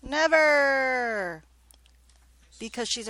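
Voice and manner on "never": theatrical teacher outburst; dramatic shout. Acted. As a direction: belt it out like a verdict. Teacher dramatically answering class.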